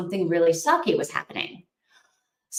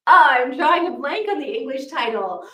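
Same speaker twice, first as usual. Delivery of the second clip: ordinary talk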